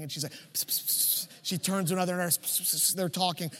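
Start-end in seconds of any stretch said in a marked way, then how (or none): 0.5-1.3 voiceless psst psst whispering noises; imitated whispering. Nurses whispering to each other about the patient, voiceless psst sounds. 2.4-2.9 voiceless psst psst whispering noises; imitated whispering. Nurses whispering to each other about the patient, voiceless psst sounds.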